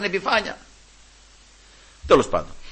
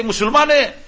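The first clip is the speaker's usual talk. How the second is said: high, raised mock call; retold calling out; 11 semitones higher, 6 dB louder than usual. Workmate hailing him at the quarry, calling out. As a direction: imitate a workmate hollering over. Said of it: "με φωνάζει".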